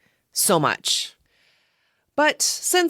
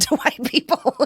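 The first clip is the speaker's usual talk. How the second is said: laughing through the words; words breaking into a giggle at one's own dig; 7 semitones higher than usual. Real, not acted.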